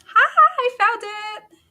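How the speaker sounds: high, gleeful singsong; triumphant little "ha ha"; playful triumph. Real, not acted. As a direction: crow it high after finally finding it. Triumph after searching for the title.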